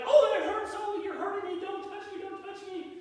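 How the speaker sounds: high, pained whining to keep people away; whiny hurt cry. Retold or imitated. Man in the fable whining at anyone touching him, high and pained. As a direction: wail like every touch burns.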